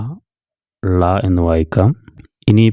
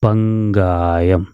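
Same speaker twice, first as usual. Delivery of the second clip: slow demo reading; much slower than usual. Shown as a demonstration. Teacher reads the first word slowly for pupils to copy. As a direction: stretch every syllable slowly and evenly. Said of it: "ഒന്ന്".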